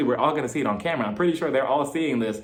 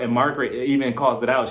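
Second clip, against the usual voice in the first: usual speaking voice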